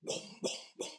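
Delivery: low, hushed arms-dealer villain; mostly whispered, halting menace; Ulysses Klaue. Acted. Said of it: "Ulysses Klaue from Avengers two".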